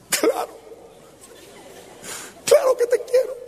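very high, squeaky mock protest; emphatic assurance. Peter assures Jesus he loves him, high-pitched protest. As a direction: protest your love in a high voice.

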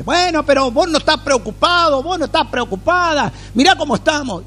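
high, fretful imitation of a worried spouse; complaining worried spouse. A worried spouse complaining to the calm believer, in a high fretful voice. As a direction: whine it like someone scolding the calm one.

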